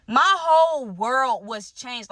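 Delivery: drawn out slowly for effect; dramatic slow pronouncement. Real, not acted. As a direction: let each word land, very slow. Dramatic reveal to viewers.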